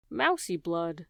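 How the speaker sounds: ordinary delivery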